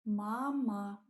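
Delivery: mama repeated slowly with stress on the first syllable; teacher repeating Mama; Russian word mama. Shown as a demonstration. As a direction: model Mama clearly for learners. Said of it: "means mom".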